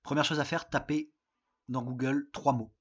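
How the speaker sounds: ordinary talk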